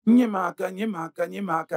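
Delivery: high, repeated praise call; admiring hail. Acted. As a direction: hail him proudly, starting loud. Father hails his son admiringly. (acclamation admirative, voix haute)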